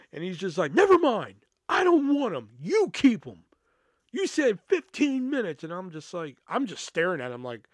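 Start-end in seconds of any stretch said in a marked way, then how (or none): 0.7-5.6 loud, high-pitched retold outburst; angry customer. Customer rejecting late wings at the counter, loud and angry. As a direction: imitate a fed-up customer refusing the order.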